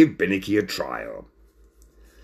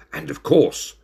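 ordinary delivery, nothing marked

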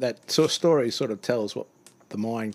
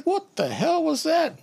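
shocked squeal; 4 dB louder, slower than usual. Retold or imitated. Driver shocked.

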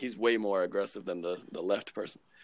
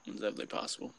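breathy whispered echo, much softer; 9 dB quieter, much faster than usual. Shown as a demonstration. To his co-host, a whispered echo of the same words. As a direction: repeat it under your breath as an aside.

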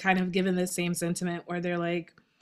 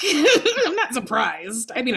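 laughing; 5 semitones higher, 5 dB louder, slower than usual. Retold or imitated. Joking about divorces they saw coming.

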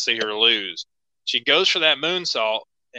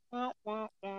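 sad trombone wah wah; 8 semitones higher, 18 dB quieter than usual. Pretend.

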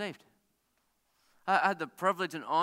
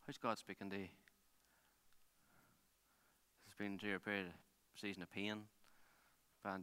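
hushed, lower voice with long pauses; soft pastoral appeal; 5 semitones lower, 9 dB quieter than usual. Real, not acted. Preacher closing and asking how God is speaking, soft pastoral appeal. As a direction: bring it right down, leaving space for reflection.

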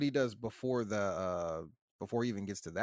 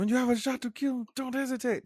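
much higher, put-on voice; mock movie quote; 14 semitones higher, 4 dB louder than usual. Podcaster quoting the film to co-hosts, high mock delivery. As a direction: act out the line in a high, loud mock voice. Acting out a retold movie line.

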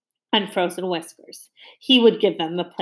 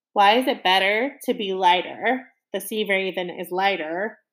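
ordinary delivery, nothing marked

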